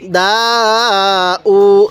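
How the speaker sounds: দা and উ each held long; one-alif elongation. Shown as a demonstration. Teacher modelling the reading, দা and উ drawn out. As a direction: stretch both vowels, দা then উ, on a steady note. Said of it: "এক আলিফ টান দিব".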